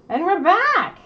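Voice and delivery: bright, loud on-air return; excited announcement. Real, not acted. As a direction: burst back in with energy. Returning on air after restart.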